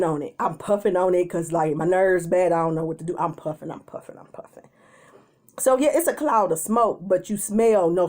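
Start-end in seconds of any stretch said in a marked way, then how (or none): 3.7-4.6 breathy whisper. Retold or imitated. Nervous in line, whispering while puffing the vape. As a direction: drop to a whisper as you puff.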